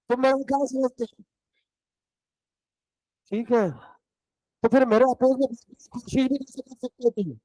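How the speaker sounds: teacher lightly mimicking a sulky pupil; mock sulky student. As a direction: play the contrary kid who argues back. Mocking a contrary student.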